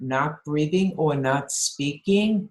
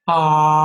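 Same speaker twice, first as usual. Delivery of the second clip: louder, open ah tone; sustained ah; 7 dB louder than usual. Shown as a demonstration. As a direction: sing out one open ah. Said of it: "Now I'm gonna make a".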